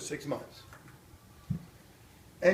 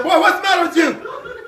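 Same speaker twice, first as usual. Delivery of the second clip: higher, louder mock mother with a laugh; scolding mother; 8 semitones higher, 5 dB louder than usual. Mother snapping at screaming son in car, mock stern. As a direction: snap at the boy like a mom. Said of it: "My mother said".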